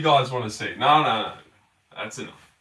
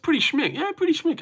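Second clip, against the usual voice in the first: mock posh praise; 5 dB quieter than usual. Admiring the jumper on stream.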